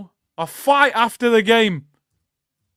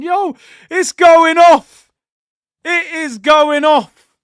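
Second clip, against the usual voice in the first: loud, drawn-out and higher; excited shout; 5 semitones higher, 7 dB louder, slower than usual. Real, not acted. Fan seeing it all go off, excited shout. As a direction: call it out slowly, thrilled by the chaos. Fan reacting to touchline trouble.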